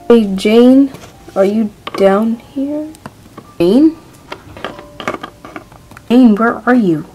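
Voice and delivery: calling out. Acted. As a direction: call for her, unsure where she is. Searching, calling for Jane.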